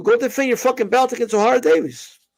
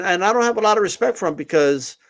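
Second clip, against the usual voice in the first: ordinary talk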